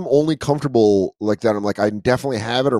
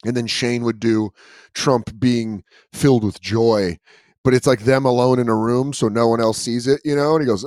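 ordinary talk, nothing marked

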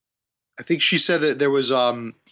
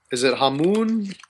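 ordinary delivery

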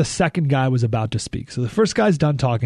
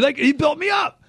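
excited shout; 12 semitones higher than usual. Real, not acted. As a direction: belt it out in amazed disbelief. Excited retelling of being flattered.

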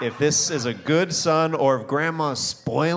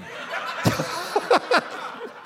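high wheezing laugh. Real, not acted. Laughing at own bit.

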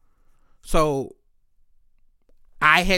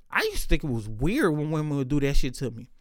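normal talking voice

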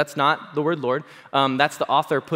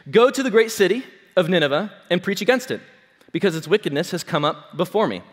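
louder, higher Bible reading voice; scripture reading; 5 semitones higher, 5 dB louder than usual. Acted. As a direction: proclaim the verse to the congregation. Reads scripture aloud.

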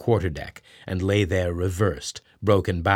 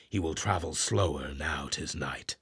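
soft breathy whisper to himself; whispered aside; 5 semitones lower, 11 dB quieter than usual. Acted. Ahab whispers to himself in the dark, quiet aside. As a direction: murmur a private thought.

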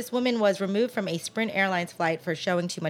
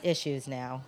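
ordinary talk, nothing marked

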